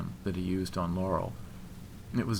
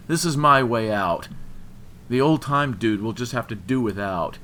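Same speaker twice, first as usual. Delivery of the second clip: loud, bitter, raised-pitch tirade; angry rant; 5 semitones higher, 8 dB louder than usual. A narrator's reading. Jilted man claiming his rival's ring, angry rant. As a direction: spit out the grievance loudly.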